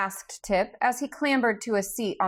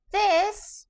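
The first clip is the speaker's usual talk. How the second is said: high squeaky voice, too small for a huge body; squeaky Gump voice; 13 semitones higher than usual. A narrator's reading. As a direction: squeak it out like an odd stuffed creature. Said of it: "in a squeaky voice".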